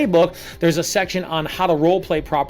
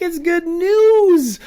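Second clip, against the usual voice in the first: much higher and louder, slow, stretched out; bursting with good news; 12 semitones higher, 6 dB louder, much slower than usual. Shown as a demonstration. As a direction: sell it like the best news ever. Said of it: "bouncing off the walls like I am right now".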